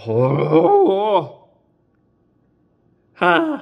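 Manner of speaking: loud high strained cries; pained cry. Real, not acted. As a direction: yelp at the sourness. Sip before rating scent.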